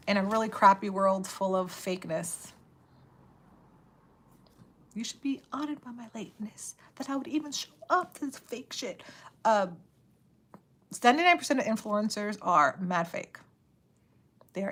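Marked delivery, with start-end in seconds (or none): 4.9-8.4 whisper. Real, not acted. Whispered joke.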